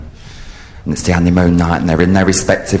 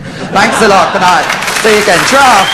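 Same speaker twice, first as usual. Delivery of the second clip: high, loud farewell; shouted sign-off; 12 semitones higher, 5 dB louder than usual. Real, not acted. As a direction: call goodbye over the applause. Shouted sign-off to cheering audience.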